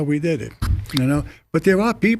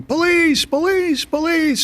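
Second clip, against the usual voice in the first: imitated yelling; 13 semitones higher, slower than usual. Cop retelling a shopkeeper's cry for help, high mock yell. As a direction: imitate a distant cry for police, pitched way up. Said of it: "somebody yelling".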